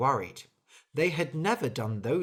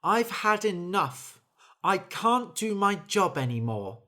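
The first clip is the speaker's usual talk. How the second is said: fed up; 6 semitones higher, 5 dB louder than usual. Acted. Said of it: "Then the bird said".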